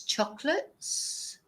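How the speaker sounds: "chocolates" as two syllables, CHOC-luts; English "chocolates", middle vowel swallowed; English, O dropped. Shown as a demonstration. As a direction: say "chocolates" slowly with no middle O, pitch rising. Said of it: "We don't even pronounce the O".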